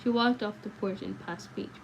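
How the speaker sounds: plain reading voice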